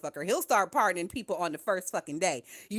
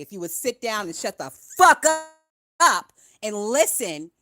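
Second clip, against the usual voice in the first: scolding; 8 dB louder than usual. Real, not acted. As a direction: make them sit down and listen. Scolding a chat commenter.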